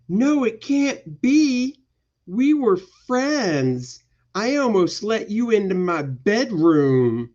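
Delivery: high, slow wail of betrayal; melodramatic heroine. Acted. Heroine to the friend who betrayed her, melodramatic dismay. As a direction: ham up a heartbroken heroine, ending on a long "no".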